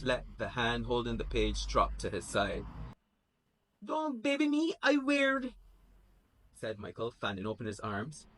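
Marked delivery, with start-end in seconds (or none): 3.8-5.5 high, indignant protest. Acted. Michael angry reply.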